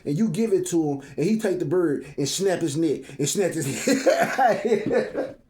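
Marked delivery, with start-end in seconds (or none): 1.7-5.4 laughing through the words. Real, not acted. Preacher cracking himself up over the pigeon, laughing. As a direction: chuckle out the punchline.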